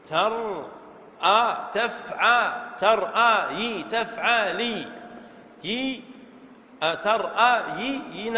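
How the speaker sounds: slow, drawn-out teaching demo; syllable by syllable. Shown as a demonstration. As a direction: stretch each syllable to show the word's pattern. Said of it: "هكذا". (مقطعًا مقطعًا ببطء)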